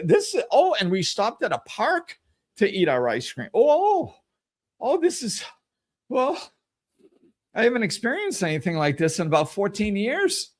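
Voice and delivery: high, gushing ice cream rapture; mock delight. Person gushing over an ice cream flavor in a hammed-up thrilled voice. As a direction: overplay the joy of a new flavor.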